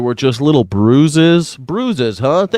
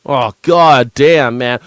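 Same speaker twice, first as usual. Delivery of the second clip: ordinary talk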